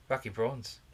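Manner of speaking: South African lady voice; South African accent. Acted. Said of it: "my South African lady voice".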